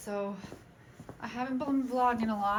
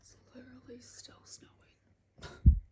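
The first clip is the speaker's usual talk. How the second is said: whispering; 2 semitones higher, 6 dB quieter, slower than usual. Real, not acted.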